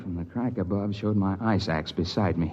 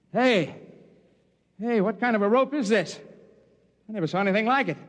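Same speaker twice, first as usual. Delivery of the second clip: narrator's younger self in the crevasse. Acted. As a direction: shout up the ice wall, cheerful and relieved.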